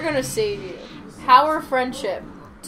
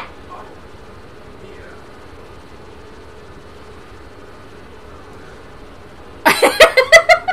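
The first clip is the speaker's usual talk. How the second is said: breathy laugh; 6 dB louder than usual. Real, not acted. Laughing at death.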